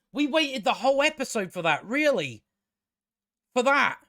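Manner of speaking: high, exasperated disbelief; can't believe that was it; incredulous outrage. Acted. Mock outrage at an anticlimax.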